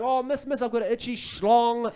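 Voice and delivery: mock-pleading child pestering the teacher; kindergarten kid.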